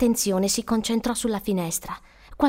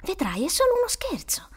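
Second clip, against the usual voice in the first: hushed, higher-pitched self-reassurance; anxious self-talk; 8 semitones higher than usual. Acted. Trapped boy telling himself it's a joke, anxious hush. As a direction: half-whisper it, trying to convince yourself. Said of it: "si disse".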